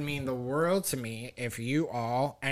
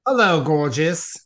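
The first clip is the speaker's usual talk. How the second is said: campy drag queen; 4 semitones higher, 13 dB louder than usual. Acted. Gushing greeting to drag guest.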